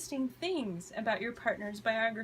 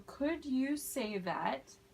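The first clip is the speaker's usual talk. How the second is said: drawn-out teacher model; slow model phrase; slower than usual. Shown as a demonstration. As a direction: stretch it out for learners. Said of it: "ask them to speak more slowly".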